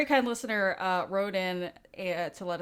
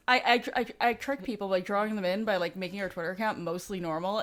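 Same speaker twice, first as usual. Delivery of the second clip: ordinary talk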